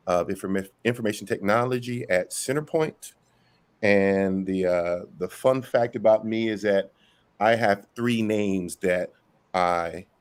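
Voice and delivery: ordinary talk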